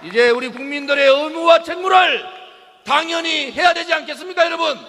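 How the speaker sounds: shouted rally oratory, rising pitch; roaring rallying speech. Real, not acted. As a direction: rouse the crowd with a fiery call. Rally speaker rousing the crowd.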